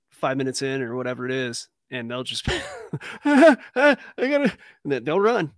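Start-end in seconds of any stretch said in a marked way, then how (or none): 3.2-4.5 loud, high panicked yelp; mock panic. Imitating freshmen hit by Tiger Balm, mock panic. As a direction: blurt it high and loud like you're burning.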